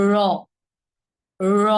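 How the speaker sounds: র pressed hard with tongue curled inward; hard, tongue back. Shown as a demonstration. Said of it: "শক্ত করে জিহ্বাটা একটু ভেতরের দিকে". (শক্ত করে, জিহ্বা ভেতরে নিয়ে র)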